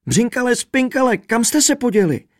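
high, loud, distraught calling; frantic raving. A narrator's reading. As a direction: wail after your lost companions. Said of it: "Běsnil a bědoval".

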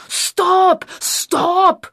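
high, loud shouts; director's yell. A narrator's reading. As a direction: yell to halt the filming. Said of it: "zařval režisér". (režisérův hlasitý řev)

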